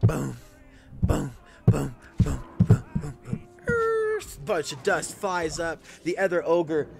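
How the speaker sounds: loud, booming storyteller build-up; dramatic narration. A narrator's reading. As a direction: narrate the crashing arrival with big energy. GM narrates troll arrival.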